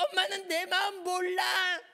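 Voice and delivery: high, loud tearful whine; acted teen wail. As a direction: bawl like a misunderstood teenager. Teen acted wailing at desk.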